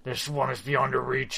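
otherworldly demon voice, retold. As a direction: intone it like a demon. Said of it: "like, demon voice".

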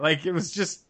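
normal talking voice

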